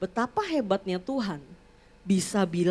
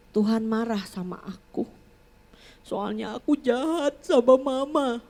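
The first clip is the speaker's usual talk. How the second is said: put-on remorseful child. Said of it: "Tahu-tahu dia bilang gini".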